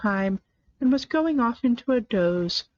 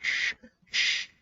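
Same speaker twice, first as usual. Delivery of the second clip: sharp unvoiced shush; whispered shh to silence Alice; 4 dB quieter than usual. A narrator's reading. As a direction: hush someone sharply. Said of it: "the Hatter and the March Hare went".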